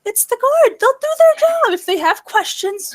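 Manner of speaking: high, loud mimicking of Beth; quoting Beth. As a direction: toss off Beth's dismissive line in a lifted voice. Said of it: "Beth's been saying".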